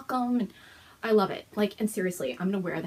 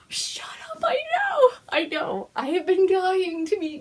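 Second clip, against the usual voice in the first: much higher, bursting into a happy shriek; thrilled squeal; 9 semitones higher, 5 dB louder, slower than usual. Real, not acted. Telling viewers she met Gail, a thrilled shriek. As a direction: squeal with disbelief and joy.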